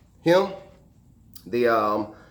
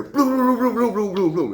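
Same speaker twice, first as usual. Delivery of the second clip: high, loud rolling rumble; rumbling noise imitation; 11 semitones higher, 5 dB louder than usual. Firefighter imitating noise from the basement, loud rumbling. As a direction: imitate a burst of commotion from below. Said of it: "a whole bunch of rumbling".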